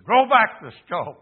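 high, loud order; preacher voicing a forceful command; commanding call. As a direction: shout the order with authority. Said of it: "and he said".

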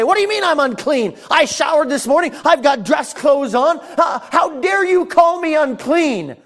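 high, loud indignant protest, hammed up; offended mock shout. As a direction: play the insulted man. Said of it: "get all offended and say".

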